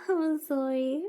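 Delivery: normal talking voice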